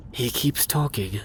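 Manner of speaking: ordinary delivery, nothing marked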